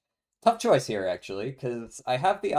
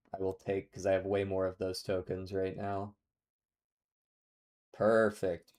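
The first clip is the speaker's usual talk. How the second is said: ordinary talk, nothing marked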